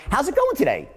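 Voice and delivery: GOING pitched high, as if you really want to know; elevated greeting. Shown as a demonstration. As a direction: lift "How's it going today?" with keen interest. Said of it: "With an elevated greeting".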